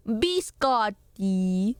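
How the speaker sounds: "Biscotti" extremely slow, rising at the end; mock-tutorial "Biscotti", long middle and lifted ending; imitated Italian pronunciation. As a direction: stretch "Biscotti" syllable by syllable, bis-KAHT-tee. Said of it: "stress on the middle syllable and on the double".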